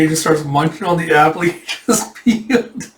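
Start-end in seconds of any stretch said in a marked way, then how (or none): none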